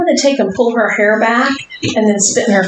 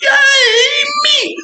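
excited cheer. Real, not acted. Cheering for herself.

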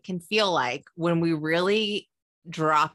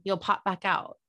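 ordinary delivery

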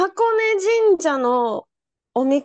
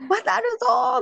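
cheering call; 8 semitones higher, slower than usual. Retold or imitated. Group cheering before crossing.

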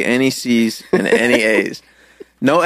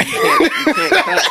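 laughing. Real, not acted. Laughing at the ad claim.

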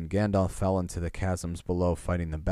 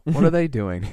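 alarmed; 9 semitones higher, 9 dB louder than usual. Acted. Frodo startled awake by orcs.

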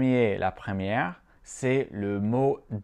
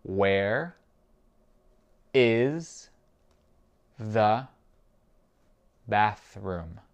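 very slow, a pause after each word; spaced-out language-drill question; much slower than usual. Shown as a demonstration. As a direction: isolate every word for a learner to repeat. Teacher repeats it word by word.

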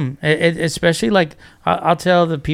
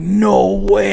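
retold amazement, higher and drawn out; disbelief; 5 semitones higher than usual. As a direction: react as if you can't believe it. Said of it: "couldn't believe it".

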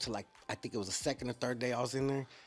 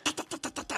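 rapid unvoiced ta-ta-ta punches; punch sounds; 3 dB quieter, faster than usual. Kid retelling throwing fast punches, rapid voiceless ta sounds. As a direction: rattle off quick punch noises.

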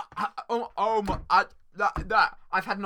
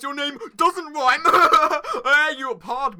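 laughing; 5 semitones higher, 9 dB louder than usual. Pretend. Teasing a friend with a name taunt.

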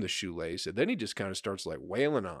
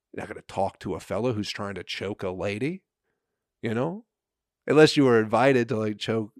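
ordinary talk

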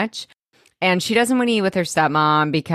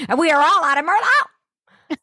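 high-pitched exasperated wail; hammed-up high exasperation; mock outburst; 11 semitones higher, 3 dB louder than usual. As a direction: act out a dramatic meltdown, building high. Acts out wine-drinking woman's rant.

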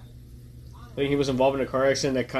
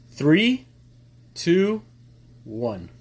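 excited countdown; 5 semitones higher, 5 dB louder, much slower than usual. Real, not acted. Counting down to start the film.

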